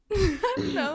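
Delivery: giggling answer; laughing. Real, not acted. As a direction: laugh through the words. Giggling reply.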